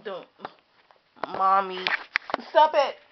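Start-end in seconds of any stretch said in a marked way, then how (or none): 1.4-3.0 playful protest. Real, not acted. Mock baby voice to mommy.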